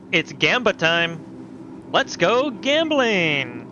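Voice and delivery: slow, drawn-out hype voice; gambling hype. Pretend. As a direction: stretch each word like a catchphrase. Hyped gambling catchphrase.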